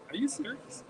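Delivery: high-pitched incredulous question; retold disbelief. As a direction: ask it as if you can't believe them. Retells own disbelieving question.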